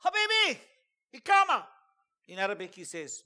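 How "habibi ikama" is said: commander's call. Retold or imitated. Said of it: "So then the guy says".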